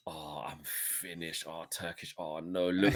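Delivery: hammed-up defeated friend; mock despair. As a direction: sigh it out like all hope is gone. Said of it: "'cause he'll go".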